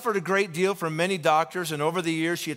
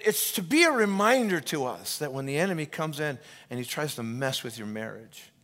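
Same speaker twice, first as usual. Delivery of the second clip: ordinary talk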